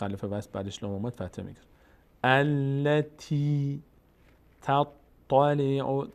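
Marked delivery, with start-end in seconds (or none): 2.2-6.1 drawn-out Quranic syllables; slow recitation demo. Shown as a demonstration. Teacher modelling words for learners, slow recitation. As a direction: hold the vowels and doubled consonants.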